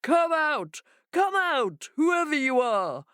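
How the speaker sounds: bang on the door and call out; shout. A narrator's reading.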